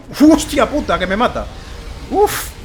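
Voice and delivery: loud high startled swear; startled cry. Real, not acted. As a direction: yelp as the fire hits you. Dragon burns him.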